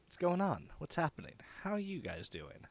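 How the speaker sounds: ordinary talk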